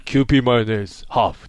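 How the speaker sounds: lightly imitated low commercial voice. Co-host quoting a mayonnaise commercial, lightly imitating the low-voiced star. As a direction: drop low for a mock mayonnaise ad. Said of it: "[name]があんなに美味しいって言ってるのに".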